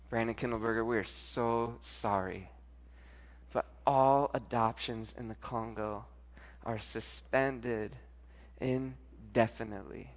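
low, slow, measured bad-news delivery; retold solemn phone announcement; grave apology. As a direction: break the bad news slowly and softly. Voicing the phone call with bad news.

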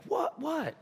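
high, short mock-puzzled questions; acted-out bewildered waking; startled awake. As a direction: play someone just woken, pitch up. Jesus acted as woken up.

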